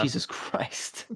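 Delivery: breathless whispered Jesus Christ; hushed disbelief at the lyrics; whispered aside. Real, not acted. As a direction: drop to a shocked whisper. Stunned reaction to lyrics.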